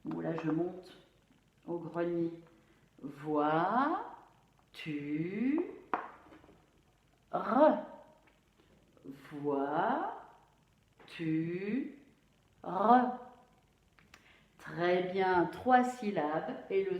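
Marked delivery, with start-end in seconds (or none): 3.0-7.8 voiture said as three syllables Vois, Tu, Re with pauses; split into syllables. Shown as a demonstration. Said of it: "On va bien découper le mot voiture". 9.3-13.2 voiture said as three syllables Vois, Tu, Re with pauses; split into syllables. Shown as a demonstration. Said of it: "On va bien découper le mot voiture".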